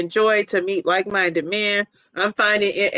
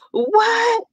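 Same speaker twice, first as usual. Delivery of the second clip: surprised; 12 semitones higher, 3 dB louder than usual. Real, not acted. Surprised at a comment.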